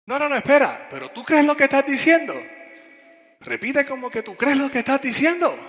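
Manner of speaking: very high, loud mock scolding; bien bravo. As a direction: challenge him fiercely, imitating a fired-up youngster. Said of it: "pero bien bravo, el jovencito". (regaño bravo imitado, muy agudo y fuerte)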